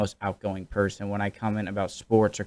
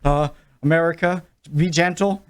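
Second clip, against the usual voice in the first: mock nervous reply; 6 semitones higher than usual. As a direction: stammer out an answer, begging mercy. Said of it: "And I'm like".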